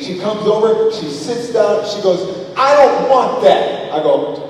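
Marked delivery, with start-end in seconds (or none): 2.6-3.9 loud, slow, higher-pitched refusal; bratty mealtime refusal; defiant child. Retold or imitated. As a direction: imitate a small child digging in. Child refusing dinner, acted.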